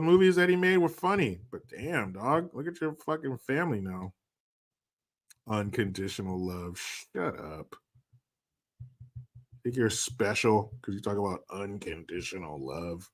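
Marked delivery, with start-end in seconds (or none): 5.5-13.1 low, soft sneering mockery; quiet lowered sneer; mocking scorn. Real, not acted. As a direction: scoff at the phrase under your breath.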